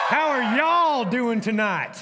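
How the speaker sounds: slow, loud drawn-out crowd greeting on y'all; rally the Texans in the crowd; loud slow Texan call-out. Shown as a demonstration. Pastor calling out to his Texan crowd, loud and slow. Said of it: "Where are my Texans?".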